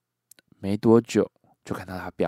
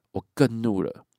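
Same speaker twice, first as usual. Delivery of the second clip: usual speaking voice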